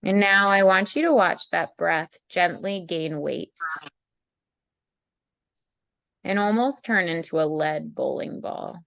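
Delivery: calm meditation voice with long pauses; guided meditation. Acted. Leads a meditation.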